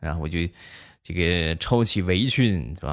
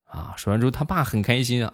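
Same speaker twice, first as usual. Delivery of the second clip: ordinary talk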